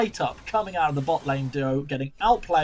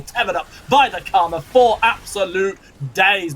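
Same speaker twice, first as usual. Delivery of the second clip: excited; 4 semitones higher, 8 dB louder than usual. Real, not acted. Caster hypes the play.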